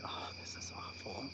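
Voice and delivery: soft whispered horror at a sad story; whispered dismay. Retold or imitated. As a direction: whisper it as if tearing up. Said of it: "I'm like".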